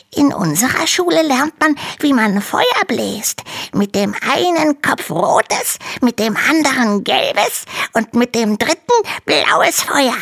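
very high, small dragon voice; little dragon voice. Acted. Little dragon complains to Hanno in a tiny high voice. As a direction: sound like a tiny, timid baby dragon. Dragon describes fire school.

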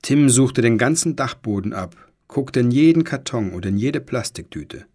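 plain reading voice

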